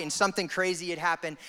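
ordinary talk, nothing marked

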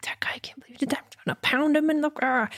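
furious words under the breath, hushed; seething, whispered from the bathroom; fuming whisper. Retold or imitated. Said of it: "And I'm just fuming".

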